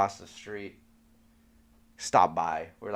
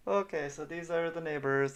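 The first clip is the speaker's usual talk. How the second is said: imitated dismay; 7 semitones higher, 3 dB quieter than usual. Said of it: "You can just see in his face".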